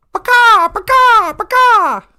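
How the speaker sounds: loud, high-pitched hen squawks; chicken squawk. Retold or imitated. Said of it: "There was a sound of a".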